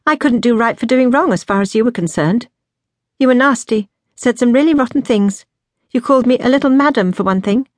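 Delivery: indignant younger sister; aggrieved accusation. A narrator's reading. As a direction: accuse your sister pointedly. Sister airs old grievances.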